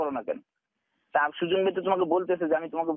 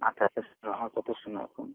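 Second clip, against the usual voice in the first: lower, softer, soothing voice; calming her down; 7 semitones lower, 4 dB quieter than usual. Real, not acted. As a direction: talk her down gently to calm her. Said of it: "আমার কথাটা এখন একটু ঠান্ডা মাথায় শোনো". (নিচু নরম গলায় শান্ত করা)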